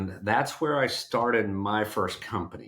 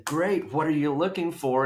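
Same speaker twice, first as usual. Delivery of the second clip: bright, upbeat pitch; eager salesman greeting; 6 semitones higher than usual. Retold or imitated. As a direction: greet them with eager energy. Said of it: "I would say".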